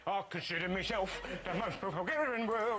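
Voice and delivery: manic slurred cockney urchin; Artful Dodger. Acted. Host imitating a self-centred Dodger, manic cockney. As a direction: gabble it as a cockney street urchin. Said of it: "He was the Artful Dodger".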